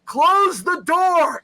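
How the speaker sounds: high, slow, loud drawn-out yell; yelling at the screen. Retold or imitated. Host shows what he nearly yelled in the theater, shouting. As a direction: bellow at the dumb characters to shut it. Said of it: "I almost yelled".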